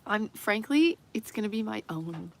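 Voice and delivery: ordinary talk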